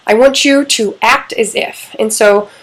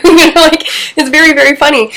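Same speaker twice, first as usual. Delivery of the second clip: laughing, higher and louder; 7 semitones higher, 6 dB louder than usual. Real, not acted. Laughing at how funny the couple's timeout gets. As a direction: laugh through it, really tickled.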